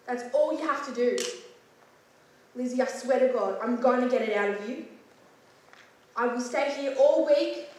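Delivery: rising from firm demand to full furious yell; building angry shout. Acted. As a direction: lose your patience and roar at the child. Detective losing temper, interrogation.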